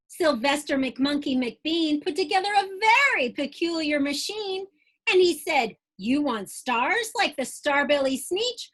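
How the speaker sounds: lively rhyming storybook read-aloud; bouncy Dr. Seuss-style read-aloud; reading to children. Acted. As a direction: read it like bedtime story time for kids. Reads picture book aloud.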